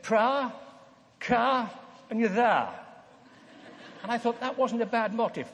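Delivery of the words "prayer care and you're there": put-on Anglican bishop's accent, "Pra, ca", vowels drawn open; bishop's inimitable accent. Preacher quotes a bishop's motto to the congregation, in the bishop's accent. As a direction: imitate the bishop's accent, turning "Prayer, care" into "Pra, ca". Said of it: "in his inimitable accent, he said".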